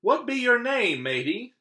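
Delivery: high, loud pirate question; pirate captain asking. Acted. Head pirate asking the boy's name, high and loud. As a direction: demand it like a gruff captain. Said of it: "the head pirate asked".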